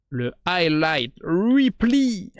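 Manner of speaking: hammed-up English accent, slow; mock English accent, drawn out. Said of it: "Avec mon accent impeccable en anglais".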